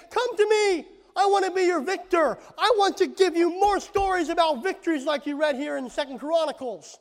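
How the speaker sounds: high-pitched, earnest call to come; impassioned appeal. Acted. Preacher voicing Jesus' longing for Israel, impassioned appeal.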